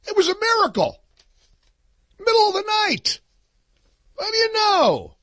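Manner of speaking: very high, sarcastic wonder; hammed-up innocent surprise; mock amazement. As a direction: marvel in an exaggerated high-pitched voice. Sarcastic mock wonder at late ballots.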